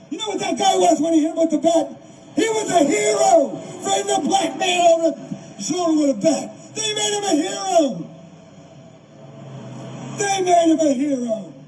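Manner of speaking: worked-up, indignant rise in pitch; impassioned indignation. Real, not acted. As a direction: protest the injustice, voice lifted high. Indignant retelling of an injustice.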